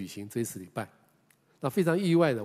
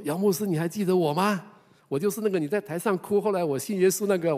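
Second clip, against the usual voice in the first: high, louder, bright recall; retold eager old lady; eager greeting; 8 semitones higher, 5 dB louder than usual. Old lady greets pastor again.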